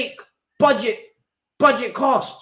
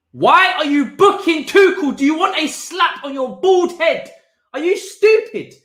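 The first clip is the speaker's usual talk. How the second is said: higher, louder, laughing outrage; high, loud, slowed indignant rant; mock rage at the ref; 6 semitones higher, 3 dB louder than usual. Real, not acted. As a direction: rant at the referee half-laughing. Raging at the referee's booking.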